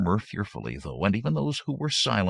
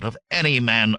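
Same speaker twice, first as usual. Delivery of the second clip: mildly heated retort; hot denial; 6 semitones higher, 6 dB louder than usual. A narrator's reading. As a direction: deny it with a touch of temper. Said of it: "Aberius said hotly".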